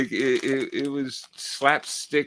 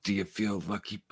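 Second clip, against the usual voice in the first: Clint Eastwood; 8 dB quieter than usual. Retold or imitated. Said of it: "our best Clint Eastwood impressions".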